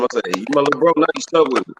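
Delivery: ordinary delivery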